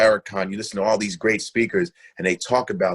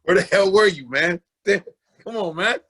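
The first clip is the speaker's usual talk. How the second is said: mock scolding; 6 semitones higher than usual. Teasing demand to friend.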